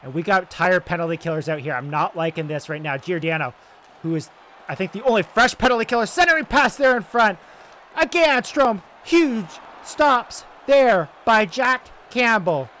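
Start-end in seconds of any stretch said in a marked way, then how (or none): 4.9-12.7 higher and louder, rising to a roar on the big stop; excited save call. Acted. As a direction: call the save like the arena is on its feet. Big save on a penalty kill.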